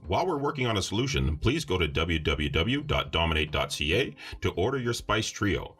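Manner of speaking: deep, smooth ad announcer; old radio announcer. Acted. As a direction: read it like a vintage broadcast. Mock station break ad read.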